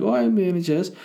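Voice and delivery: high, loud, put-on smug voice; mock self-important. Mocking people who claim credit, in a high smug voice. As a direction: squeal it like a show-off. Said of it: "those people being like".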